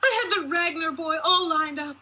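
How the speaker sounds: shrill-pitched mother shaming her daughter; raging mother. A narrator's reading. Mother berating her unmarried daughter, in a high raging voice. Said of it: "as she spewed the ugly words".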